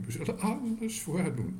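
eager promise. A narrator's reading. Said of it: "riepen de tantes".

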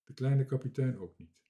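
plain reading voice

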